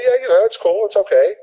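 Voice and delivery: a lightly voiced server brushing off the question; server's reply. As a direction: give a vague, polite yes. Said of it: "She just say".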